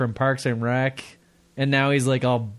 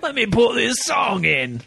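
mock English accent; 6 semitones higher than usual. Cohost mimicking the English caller, a laughing mock English accent. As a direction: put on a jokey English voice through laughter. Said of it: "Wait a minute, mate".

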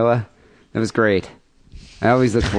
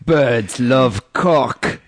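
slow, heavy orc voice; 3 semitones higher, much slower than usual. Retold or imitated. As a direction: say it like a Lord of the Rings orc. Host quotes caller's orc line.

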